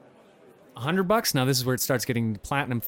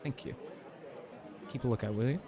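hushed from under the table; quiet, hiding below the desk; ducking under a table; 4 semitones lower, 12 dB quieter than usual. Real, not acted. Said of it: "I'm under the table".

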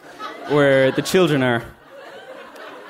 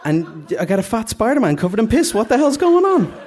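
rising from indignant to high, loud outrage; exasperated mother's rant; 7 semitones higher, 4 dB louder than usual. Acted. Mother complaining about the ruined party, building to shrieked outrage.

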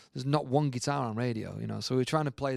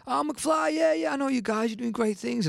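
much higher, chirpy mock Elton greeting; voicing [name]; 13 semitones higher than usual. Elton welcoming the young band, a high mock greeting. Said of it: "so he goes".

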